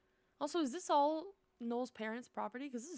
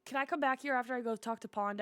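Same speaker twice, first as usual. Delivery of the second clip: usual speaking voice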